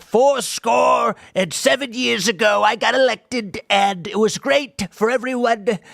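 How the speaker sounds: booming parody of a big speech; mock Trump speech. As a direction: ham up a pompous presidential address. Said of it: "he was just like".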